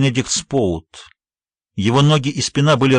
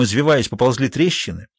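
plain reading voice

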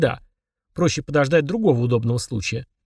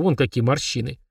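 ordinary delivery, nothing marked

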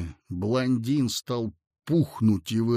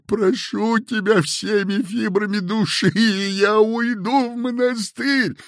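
high, loud, slow sobbing plea; begging for documents through tears; a much higher, wailing entreaty; tearful begging; 6 semitones higher, 5 dB louder, slower than usual. A narrator's reading.

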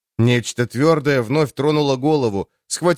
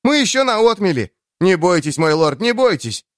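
shouting; 6 semitones higher than usual. Acted. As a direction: shout it out, straining to be heard. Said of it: "едва слышно кричал охотник".

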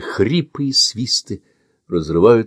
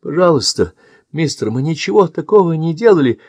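higher, louder tearful begging; wailing plea; 8 semitones higher, 3 dB louder than usual. Acted. Boy begs the stranger to let go, mild wail. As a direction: plead like a terrified child. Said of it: "завываю я".

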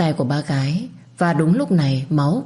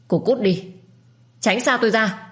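sharp, raised pitch; angry dismissal; 6 semitones higher than usual. A narrator's reading. Lâm telling her to get away, pained anger. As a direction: push her away with a strained cry.

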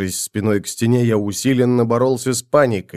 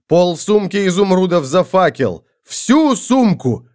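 desperate; 7 semitones higher than usual. A narrator's reading. Desperate offer in panic.

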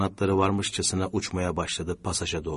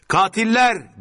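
loud, high accusing cry; outraged scream; 12 semitones higher, 7 dB louder, slower than usual. A narrator's reading. Sami Bey screams at the gunmen, outraged. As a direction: scream it after the culprits. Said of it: "diye haykırdı".

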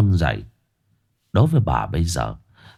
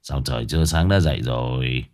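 drowsy slurred; 8 semitones lower, 3 dB quieter than usual. Acted. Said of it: "lè nhè hỏi".

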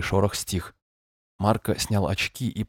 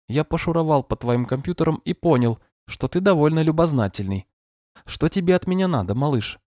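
gentle but monotone synthetic voice, higher; screen reader voice; 7 semitones higher than usual. Acted. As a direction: read it like a calm machine voice. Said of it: "звучал ласково, но монотонно".

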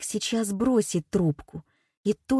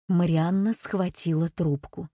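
ordinary delivery

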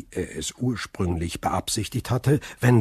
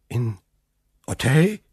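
startled question; 2 semitones higher than usual. Acted. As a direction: caught off guard, repeat the name. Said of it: "rief von Villefort".

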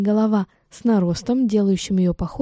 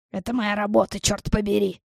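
indignant. A narrator's reading. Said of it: "заявила она".

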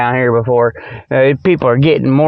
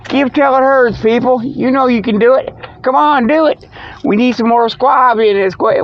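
higher-pitched, urging donation pitch; mock telethon plea; 8 semitones higher than usual. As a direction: coax listeners to give, pitched up high.

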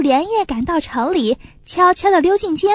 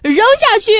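loud, much higher furious command; loud yell; 7 semitones higher, 4 dB louder than usual. A narrator's reading. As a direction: yell the order at the soldiers. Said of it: "大叫一声". (大叫)

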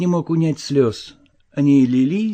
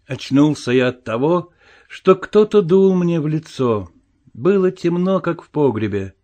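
ordinary delivery

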